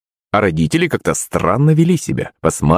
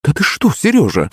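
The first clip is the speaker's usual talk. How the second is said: higher-pitched indignant exclamation; exclaiming; 7 semitones higher than usual. A narrator's reading. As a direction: exclaim in mild shock. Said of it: "воскликнула Маман". (с возгласом)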